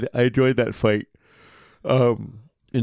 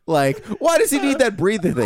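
laughing; 10 semitones higher than usual. Pretend. Mimics producer, laughing.

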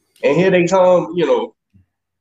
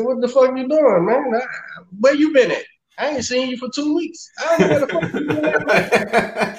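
pitched up, laughing and scolding; mock-outraged at the door, going higher; higher, laughing outrage; 7 semitones higher than usual. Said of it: "I said".

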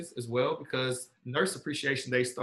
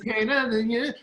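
laughing through the words; giggling as you say it; 9 semitones higher, 5 dB louder than usual. Real, not acted. Laughing at song reference.

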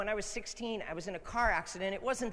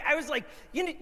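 usual speaking voice

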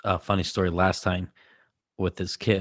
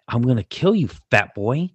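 louder, mock-menacing kid; mock angry threat; 4 semitones higher, 5 dB louder than usual. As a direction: imitate a mad kid's threat. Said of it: "She's mad again with that".

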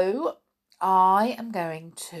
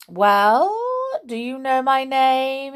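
high, loud, taunting creature voice; 8 semitones higher, 7 dB louder than usual. A narrator's reading. Creature challenging the queen, taunting. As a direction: rise high on a held challenge. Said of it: "he asked".

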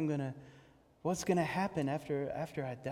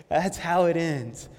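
ordinary talk

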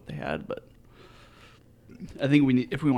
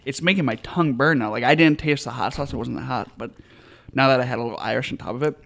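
normal talking voice

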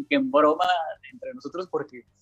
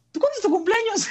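mock innocent question. Asking girlfriend her birthday.

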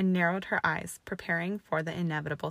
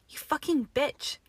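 high, slow shout of pain; a slow shout of burning pain; 9 semitones higher than usual. A narrator's reading. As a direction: shout out as the burn hits. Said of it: "he shouted".

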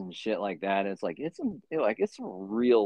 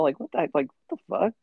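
incredulous; 11 semitones higher, faster than usual. Retold or imitated. Imagined retort to cellmate.

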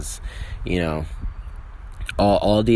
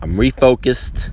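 mock declaration; 7 semitones higher, 6 dB louder than usual. Real, not acted. Mock-announcing himself refocused.

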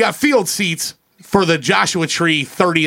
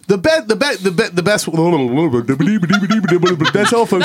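Porky Pig impression. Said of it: "Porky Pig in it".

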